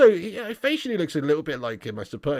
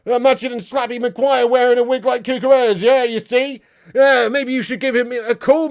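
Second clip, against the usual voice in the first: loud, high-pitched taunting rant; mock outrage; 6 semitones higher, 7 dB louder than usual. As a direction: wind him up with a loud mock tirade. Mock tirade at chat commenter.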